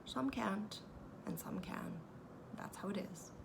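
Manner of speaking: soft whispered shrug of a thought; whispered musing. A narrator's reading. Pooh thinking it over in a hushed whisper. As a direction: let it fade to a whisper. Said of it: "he thought".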